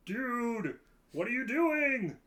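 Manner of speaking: Henchman 24 impression. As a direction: put on the goofy henchman voice. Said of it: "I can do the henchman".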